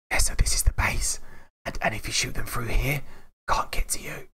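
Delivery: put-on hushed whisper so the baby stays asleep. New dad recording beside sleeping daughter, whispering. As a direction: breathe the words without voice. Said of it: "I'm whispering".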